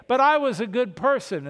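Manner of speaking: high, loud mock plea of innocence; pleading protest. A sinner at judgment insisting he was good, in a loud mock plea. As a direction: protest your goodness before the open books. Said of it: "you're gonna say".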